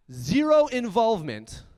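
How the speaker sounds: zero hit high and loud, dragged slow; stress on zero. Real, not acted. Keynote speaker stressing Nike put in zero, punched zero. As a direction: lean hard on the word zero. Nike had no part in the show.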